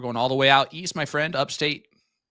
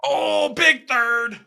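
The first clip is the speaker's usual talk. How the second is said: high, slow stretched excited call; 13 semitones higher, 4 dB louder, much slower than usual. Real, not acted. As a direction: draw out the thrill of the big dart. Big dart hits on live call.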